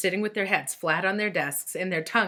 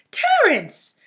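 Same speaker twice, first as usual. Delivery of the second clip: high, loud scolding call of a name; sharp exclaim; 8 dB louder than usual. A narrator's reading. Missus Jules scolding Terrence, a loud sharp exclaim. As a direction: snap his name out to stop him. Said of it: "exclaimed Missus Jules".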